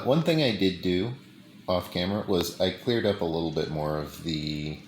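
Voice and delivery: ordinary delivery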